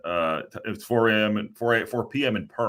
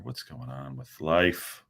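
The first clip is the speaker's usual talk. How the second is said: normal talking voice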